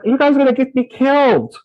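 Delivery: high-pitched, hammed-up alarm; half-joking plea for his life; mock panic. Boy to classmates dragging him into magic, mock panic.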